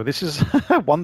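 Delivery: ordinary delivery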